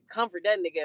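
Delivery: ordinary talk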